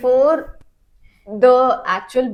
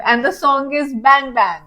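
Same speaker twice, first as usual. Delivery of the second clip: ordinary talk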